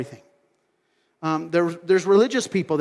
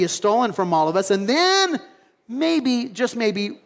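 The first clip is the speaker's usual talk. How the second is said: disbelieving disciples; 4 semitones higher than usual. Retold or imitated. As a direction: balk at the plan in disbelief. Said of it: "the disciples were like".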